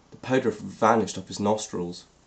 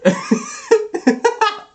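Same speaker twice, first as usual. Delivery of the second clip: shrieking burst of laughter, rising; high squealing laugh; 6 dB louder than usual. Real, not acted. Cracks up at Hermione.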